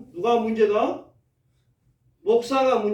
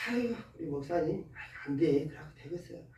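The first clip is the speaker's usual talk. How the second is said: muttered grumbling; 3 semitones lower, 11 dB quieter than usual. Retold or imitated. Voices grumbling members.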